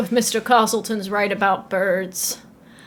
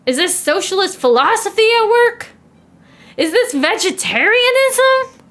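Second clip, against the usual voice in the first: louder, much higher, scandalised questions; mounting outrage; 10 semitones higher, 4 dB louder than usual. Acted. Editor suspecting Lewis of radical plots, outraged. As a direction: climb to an appalled peak on the last word.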